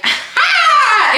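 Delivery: a sharp, very high mock "Ha!"; a short, loud, squeaky triumphant laugh; inner mock laugh. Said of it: "in my head".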